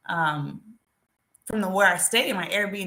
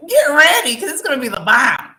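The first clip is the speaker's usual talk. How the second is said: hyped up; 5 semitones higher, 10 dB louder than usual. Real, not acted. Hyping upcoming web series.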